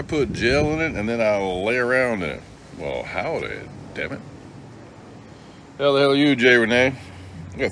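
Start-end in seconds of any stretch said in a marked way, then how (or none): none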